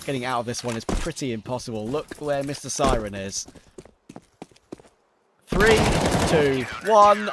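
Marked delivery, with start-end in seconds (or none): 5.5-7.3 excited countdown. Real, not acted. Caster counts down a play.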